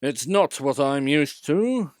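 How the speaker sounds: a proud engine defying the fireman; rebellious. A narrator's reading. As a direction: clank it out in defiance. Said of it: "clanked Sir Handel rebelliously".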